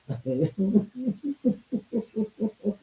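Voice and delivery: real laughter rising high and breathless; helpless laughing at the memory; high giggling fit. Real, not acted. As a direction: let the giggle take over. Laughing fit retelling Rickles encounter.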